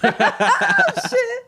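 high squealing laugh. Real, not acted.

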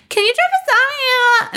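high, whiny mock plea; desperate plea. Stuck in the cab with dog vomit, a high whiny plea to the driver. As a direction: beg the driver in a squeaky voice. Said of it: "until I'm like".

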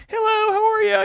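squeaky high put-on hello; high cartoonish hello; high mock greeting. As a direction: chirp it in a silly high voice.

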